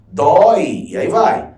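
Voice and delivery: ordinary talk, nothing marked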